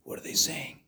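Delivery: whispered question; hushed curiosity. Acted. Visitor gets closer to the chanting crowd, hushed curiosity. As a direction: whisper it while straining to make out words.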